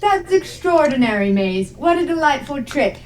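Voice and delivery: high, lilting genteel praise; dainty, delighted exclaiming; admiring Agnes. Acted. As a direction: marvel indulgently at a child's trick. Agnes praises the child's trick.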